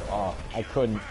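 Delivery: usual speaking voice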